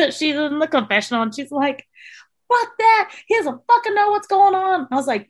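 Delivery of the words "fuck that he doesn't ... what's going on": high, loud angry outburst retold; imitated pissed-off protest; pissed-off friend. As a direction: act out her indignant rant. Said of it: "she was pissed".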